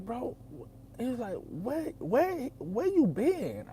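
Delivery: high, delighted where you been; light up at seeing an old friend; excited greeting. Retold or imitated. Happy greeting his old friend after years, high and delighted.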